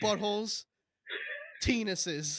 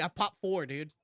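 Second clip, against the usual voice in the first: normal talking voice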